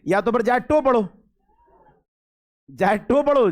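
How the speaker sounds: "टो" said with a rounded ओ instead of the English "to"; mock spelling-out. Shown as a demonstration. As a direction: read "जाए टो" letter by letter, mockingly. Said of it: "काहे पढ़ रहे गो". (मज़ाक में "टो" पढ़ना)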